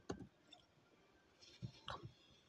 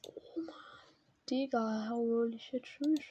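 hushed, awed whisper; stunned whisper at the screen; 3 semitones lower, 15 dB quieter, slower than usual. Real, not acted. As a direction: breathe it out in awe. Awed hush at a rare skin.

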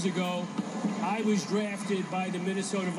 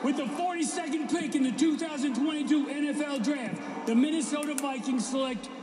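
draft announcer; 4 semitones higher than usual. Acted. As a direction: announce the pick to the crowd. Reading the draft pick card.

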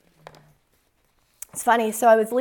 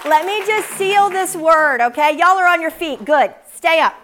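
rousing preacher call; 5 semitones higher, 7 dB louder than usual. Real, not acted. Calls congregation to stand.

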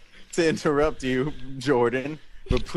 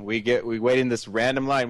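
normal talking voice

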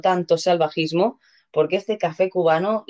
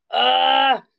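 pained cry; 7 semitones higher than usual. Real, not acted. Arm hurts, can't climb up.

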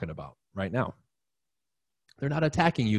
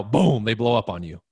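ordinary talk, nothing marked

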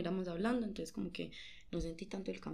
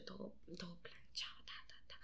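whisper; 14 dB quieter than usual. Real, not acted. Describe su arreglo en susurro.

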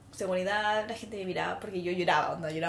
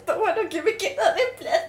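higher, laughing mock plea; mock pleading; 9 semitones higher, 5 dB louder than usual. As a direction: camp up the desperate offer. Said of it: "No me queda nada".

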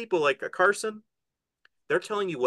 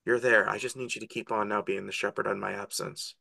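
lightly voiced gentle plea, softer and lower; Jesus to Peter; 6 semitones lower, 4 dB quieter than usual. Jesus asking Peter to shepherd in his absence, gentle acted plea. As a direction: entrust the flock to a friend, quietly. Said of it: "What does he say to him?".

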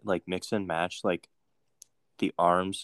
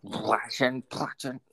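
garbled cartoon cursing; cartoon character imitation; 4 semitones higher, slower than usual. Trying out a half-remembered cartoon voice, grumbled cursing. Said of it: "it's from a cartoon".

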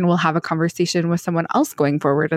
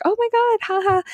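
much higher, breathless fake delight; mock starstruck squeal. Playing it cool after a fan recognizes her, high mock squeal. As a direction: squeal like a flattered celebrity. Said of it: "So I was like".

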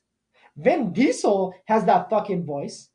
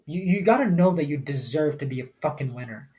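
ordinary talk, nothing marked

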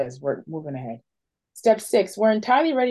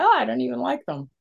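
dawning surprise, slightly higher; sudden realization; 4 semitones higher than usual. Retold or imitated. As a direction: realize it mid-sentence. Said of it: "And then it's like".

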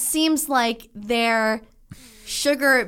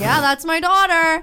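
louder, slow, proud confirmation; dad's proud reply; 6 semitones higher, 7 dB louder than usual. Retold or imitated. Dad confirms to the dealer lady, slow and proud. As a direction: savour every word like a proud father. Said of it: "my dad was like".

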